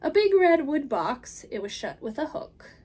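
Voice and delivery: plain reading voice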